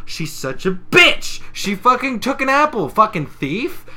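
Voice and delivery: high, loud indignant accusation; mock outrage. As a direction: act out scandalised fury. Retold character's outrage at theft.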